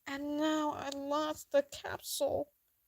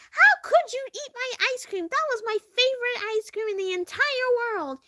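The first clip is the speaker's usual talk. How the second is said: much higher, louder scolding mother; angry mother; 8 semitones higher, 6 dB louder than usual. Retold or imitated. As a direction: play the upset mother scolding. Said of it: "And she's like".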